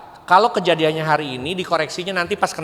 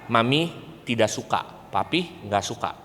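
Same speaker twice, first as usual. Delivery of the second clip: low, quiet, firm correction; serious firm; 6 semitones lower, 7 dB quieter than usual. Shown as a demonstration. Parent correcting a child's rude words, low and serious. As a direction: state it low and final.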